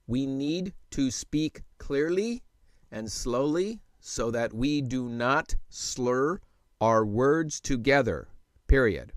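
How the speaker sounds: slow and deliberate dictation voice; slow, clear dictation. Shown as a demonstration. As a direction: speak each word clearly and slowly. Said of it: "let me go ahead and show you what I'm talking about".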